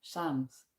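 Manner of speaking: quiet "Psalms" as "sahmz"; asking if wrong. Shown as a demonstration. Asking a friend whether her Psalms sounds wrong, quiet. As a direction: offer "Psalms" softly as "sahmz" for judging. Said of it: "does it sound wrong if I say".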